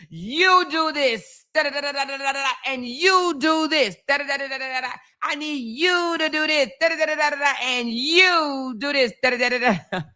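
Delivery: high, bossy mock orders with da-da filler; bossy Power Ranger leader. Streamer mocking how fans see her as the leader, high bossy orders. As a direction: mimic fans taking orders from a team leader. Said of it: "they reverence me like I'm some, uh, Power Ranger leader".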